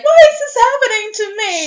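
put-on high, loud panicked teenage wail. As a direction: cry out like a scared teen girl. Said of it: "you're like".

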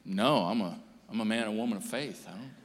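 low, mock-pious voice; a hint of a self-righteous believer; pious denier. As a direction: play the smug faithful one. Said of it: "oh, good for you".

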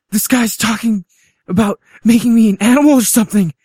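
high, loud frantic pleading; scared. Acted. Abducted man phoning Allison for help, scared.

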